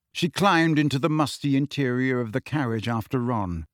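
usual speaking voice

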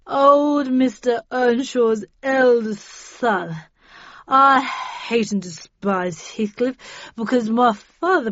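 put-on loud, slow, drunken grievance; heavy, dragged drunken ranting; slurring drunk. A narrator's reading. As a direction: slur it out, drunk and bitter. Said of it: "slurred the drunk".

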